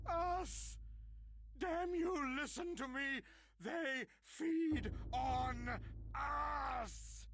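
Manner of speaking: high, loud frantic yelling; desperate shout. Acted. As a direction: scream the warning at them. Madman shouts warning.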